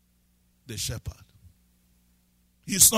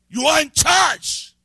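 loud, high and slow, shouting each phrase; full-force preaching; 9 semitones higher, 8 dB louder, slower than usual. Acted. Preacher proclaiming life over death to the church, shouting. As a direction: thunder it out over the congregation.

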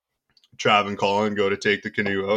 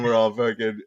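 ordinary talk

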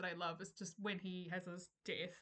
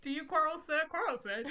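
mock challenge; 7 semitones higher, 6 dB louder than usual. Quoting Shakespeare street quarrel.

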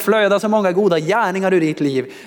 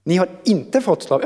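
"inte" punched higher than the words round it; stress on INTE; 4 semitones higher than usual. Shown as a demonstration. Preacher rereading the verse with relief, stress on "inte". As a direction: hit the word "inte" hard, then break off. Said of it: "det var ett inte där".

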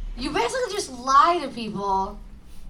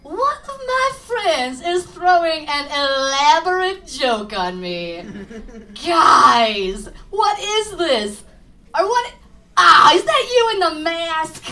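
naive horror victim; 4 semitones higher, 7 dB louder, slower than usual. Retold or imitated. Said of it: "my first thoughts are not".

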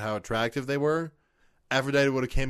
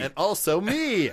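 high, slow, loud and playful; excited; 8 semitones higher, 4 dB louder than usual. Real, not acted. Joking reply, high and loud.

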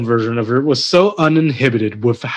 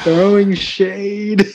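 THROWING SHADE stretched out very slowly, higher; drawn-out teasing; 4 semitones higher, much slower than usual. Real, not acted. Co-host teasing another about slam money, drawn-out THROWING SHADE. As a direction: draw out each word of THROWING SHADE.

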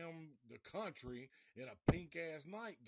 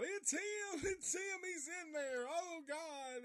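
panicked shout; 14 semitones higher, slower than usual. Retold or imitated. Skeeter finding Bubba in the coffin, high terrified shouting. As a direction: scream it like you've seen a ghost. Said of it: "he freaks the fuck out".

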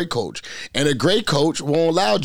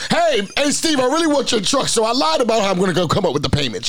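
high mock voice, a sly liar; mocking coach voice; 7 semitones higher than usual. Imitating coach McCarthy lying.

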